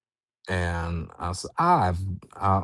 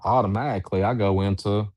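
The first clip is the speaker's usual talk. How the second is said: ordinary delivery, nothing marked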